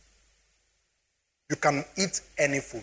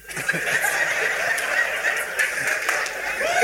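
a burst of high, breathy laughter. Real, not acted. Telling the audience about meat in his rice, high laughter.

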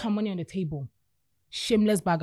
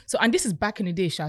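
normal talking voice

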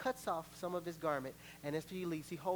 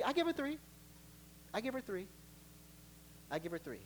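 fading counted warnings; 3 semitones higher, 8 dB quieter, faster than usual. Acted. Parent warning a disobedient kid, fading softer. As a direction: count it down, getting quieter.

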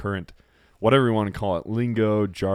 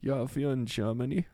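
put-on a light, slightly higher German accent; half-hearted German accent; 5 semitones higher, 4 dB quieter than usual. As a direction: try the German accent again. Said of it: "That was a horrible accent".

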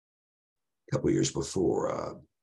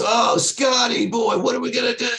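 much higher, loud cackling voice of a wild friend; Ray's crazy laugh; 4 dB louder than usual. Retold or imitated. Drunk friend mocks driver being pulled over, high cackling. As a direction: crow it out, half laughing at his buddy's trouble. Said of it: "started laughing that crazy laugh of his".

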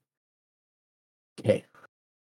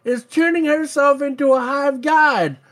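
nerdy lore fan; 12 semitones higher than usual. Pretend. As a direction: gush like an eager lore nerd. Imagined firefighter dropping lore.

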